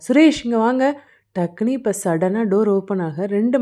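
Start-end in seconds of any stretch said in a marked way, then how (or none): 0.1-1.0 high, loud cry for help; shocked call. A narrator's reading. Vidya sees the door handle move, shocked call. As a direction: call out in sudden alarm. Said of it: "வித்யா அதிர்ச்சி ஆயிட்டா".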